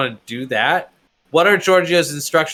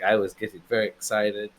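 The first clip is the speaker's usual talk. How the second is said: put-on wobbly Greek accent drifting toward Russian; 6 semitones lower, 4 dB quieter than usual. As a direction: attempt a Greek accent, lower and softer. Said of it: "Sounds kinda Russian now".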